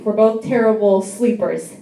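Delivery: usual speaking voice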